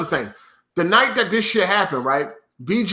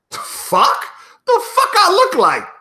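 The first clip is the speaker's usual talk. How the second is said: incredulous outburst; 10 semitones higher, slower than usual. Real, not acted.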